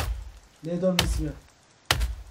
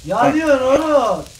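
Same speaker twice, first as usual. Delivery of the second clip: shouting; 6 semitones higher, 11 dB louder, much slower than usual. Real, not acted. Friend burning in game, yelling.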